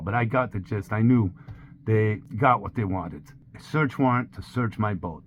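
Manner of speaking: ordinary delivery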